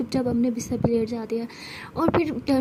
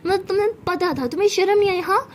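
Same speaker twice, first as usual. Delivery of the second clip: indignant scolding; 5 semitones higher than usual. Acted. Sara tells off Jais for laughing at her, indignant. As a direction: scold a teasing friend in disbelief.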